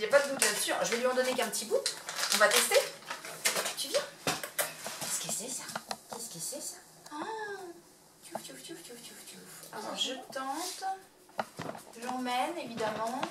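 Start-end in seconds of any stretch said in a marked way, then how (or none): 3.8-7.2 soft whisper to the puppy. Real, not acted. Owner teasing the puppy with a treat, whispering. 8.2-9.7 whispered tchouf tchouf play sounds; hushed playful tchouf noises; soft tchouf noises. Real, not acted. Owner enticing the puppy with hushed tchouf sounds.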